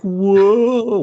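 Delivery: high, loud mock whoa. Acting the wacky comedy moment with a loud high whoa. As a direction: throw out a cartoon whoa. Comic exclamation.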